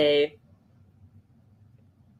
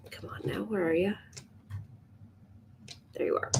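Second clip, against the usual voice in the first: whispering; 14 dB quieter, faster than usual. Real, not acted. Coaxing while searching for something.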